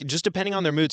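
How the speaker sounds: ordinary delivery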